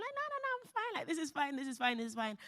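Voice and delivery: high, falsely breezy this is fine; forced denial. Retold or imitated. Exhausted mother insisting to herself she's fine, high and breezy. Denying she's not okay.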